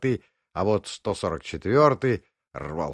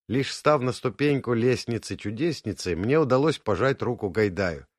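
usual speaking voice

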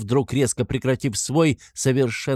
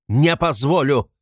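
loud, raised, slow refusal; furious shout; 5 semitones higher, 5 dB louder, slower than usual. A narrator's reading. Raskolnikov defying the investigator to his face, furious shout. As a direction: hammer it out at high volume. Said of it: "крикнул он вдруг изо всей силы".

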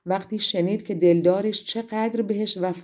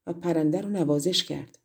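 ordinary delivery

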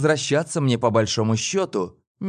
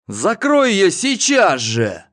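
loud, slow, booming command; thundering order; 8 semitones higher, 6 dB louder, slower than usual. Acted. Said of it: "прогремел голос". (громовой приказ)

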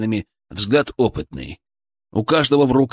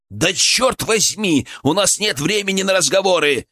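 exasperated outburst; 7 semitones higher than usual. A narrator's reading. Healer cutting off the paramedics' talk, high exasperated urgency. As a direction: burst out high with impatience.

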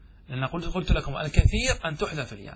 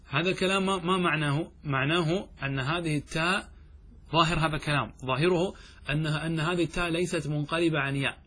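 usual speaking voice

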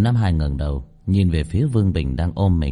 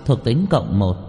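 ordinary delivery, nothing marked

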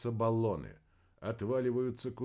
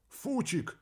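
sharp high yell of a name; 13 semitones higher than usual. Acted. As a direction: scream the name in alarm. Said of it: "заорал радист".

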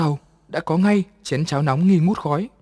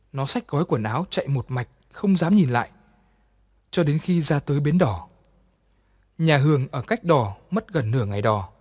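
ordinary delivery, nothing marked

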